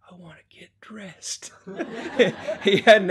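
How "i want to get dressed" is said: imitating a frail son's whispered wish; soft, strained whisper; weak whisper. Sick son telling his father his wish, weak whisper. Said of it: "just barely able to whisper".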